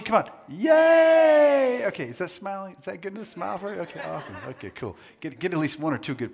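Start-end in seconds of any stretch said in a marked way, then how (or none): 0.5-1.8 loud, very high held yay; happy cheer. Shown as a demonstration. Preacher leading the crowd's happy emoji practice, loud happy cheer. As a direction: belt out a long high yay. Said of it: "Happy emoji".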